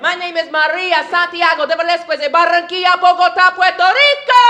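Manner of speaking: put-on high, showy Spanish-accented roll call, building to a yell; Puerto Rican Spanish accent. Proud Puerto Rican announcing her name, Spanish accent. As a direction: rattle it off in a Spanish accent, finish hollering.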